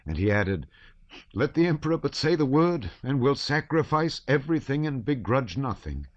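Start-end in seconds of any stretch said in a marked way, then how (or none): 1.3-6.1 put-on high, ardent old count; rally the room, pitched up high; patriotic fervour.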